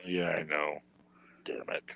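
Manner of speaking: hushed whispered aside. Real, not acted. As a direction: whisper it under your breath. Whispered aside about wife.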